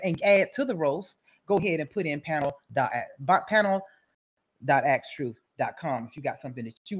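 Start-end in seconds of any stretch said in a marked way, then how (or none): none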